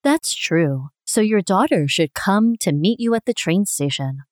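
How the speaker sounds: normal talking voice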